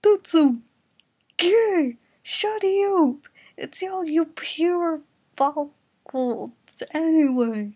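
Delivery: high, loud put-on squabbling; loud bickering. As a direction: snipe back and forth. Mocking bickering in car.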